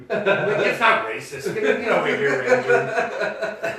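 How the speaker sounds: talking through laughter; laughing. Real, not acted. Storyteller insisting to coworker, laughing through the words.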